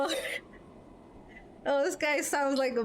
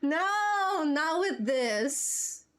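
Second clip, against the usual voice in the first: dismayed no; 6 semitones higher, much slower than usual. Real, not acted. Streamer dreads a sad scene.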